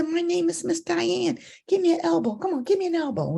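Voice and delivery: high, cooing voice for a little one; friendly to child. Retold or imitated. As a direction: greet a small child playfully. Introducing herself to the child.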